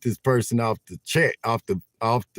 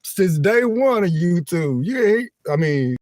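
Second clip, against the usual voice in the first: higher, louder mock scolding; playful mock complaint, building higher; imitating viewers; 5 semitones higher, 4 dB louder than usual. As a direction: tease him like friends fed up. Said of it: "y'all probably like".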